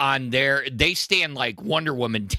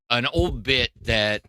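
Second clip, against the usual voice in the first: ordinary delivery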